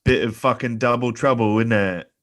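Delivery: put-on a deliberately cheesy London accent on "double trouble"; bad London accent. Joking with friends on a podcast, bad London accent. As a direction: ham up a London accent badly, for the laugh. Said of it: "that's a fantastic accent".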